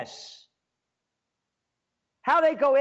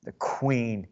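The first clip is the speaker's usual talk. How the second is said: ordinary talk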